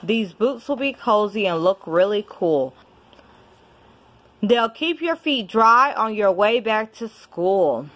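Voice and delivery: usual speaking voice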